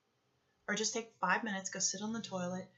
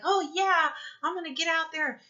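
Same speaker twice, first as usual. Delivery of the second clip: put-on a higher, louder, bright-eyed little kid; eager child; 8 semitones higher, 6 dB louder than usual. Speaker voicing a child eager to take on the world, excited. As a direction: play the eager toddler ready to go.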